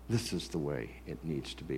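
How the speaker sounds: stubborn voice. Retold or imitated. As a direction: growl it like a stubborn old-timer. Mocking stubborn voice.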